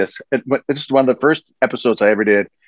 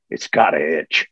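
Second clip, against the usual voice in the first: Wolverine's line; 7 semitones lower, slower than usual. Retold or imitated. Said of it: "He says".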